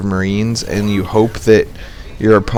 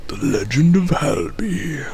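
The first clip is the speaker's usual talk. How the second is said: epic trailer voice; 6 semitones higher, 6 dB quieter, slower than usual. Pretend. Epic announcement.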